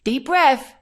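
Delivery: put-on high, loud little-girl voice; imitating a toddler. Two-year-old niece calming a stressed aunt, high and loud. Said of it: "she'll say".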